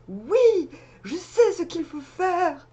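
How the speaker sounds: high, slow, proud declaration; triumphant voice. Pretend. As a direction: announce the answer like a know-it-all. Voicing the card's figure.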